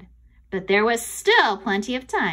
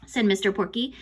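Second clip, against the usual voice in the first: ordinary delivery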